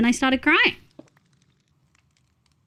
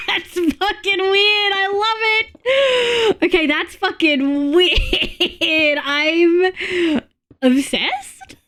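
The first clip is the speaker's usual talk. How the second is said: laughing; 7 semitones higher, much slower than usual. Real, not acted. Laughing at partner's odd habit.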